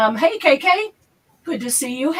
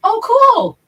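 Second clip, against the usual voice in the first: excited; 12 semitones higher, 9 dB louder than usual. Real, not acted. Reacting to the name Dawn Marie.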